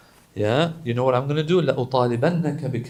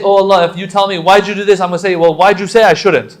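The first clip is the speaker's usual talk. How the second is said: raised, higher retorting voice; mock argument; 5 semitones higher, 10 dB louder than usual. Preacher showing the wrong way to argue with God, raised and higher. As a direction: act out talking back, loud. Acting out arguing with Allah.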